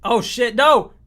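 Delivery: high, loud shocked cry; alarmed shout. Real, not acted. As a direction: yelp in sudden dismay. Real mishap on screen.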